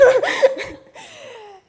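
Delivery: high, loud giggle; happy laughing burst. Real, not acted. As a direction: laugh out happily. Bride laughs after thank you.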